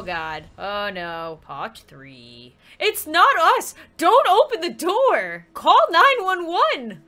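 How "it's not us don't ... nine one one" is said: loud, high panicked mom; raised voice, each order punched; urgent warning. Acted. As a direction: shout an urgent warning to your child. Mom warns of stranger outside.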